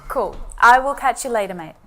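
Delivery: cheery, friendly mate greeting; friendly Aussie hello; super friendly. Shown as a demonstration. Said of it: "It's super friendly".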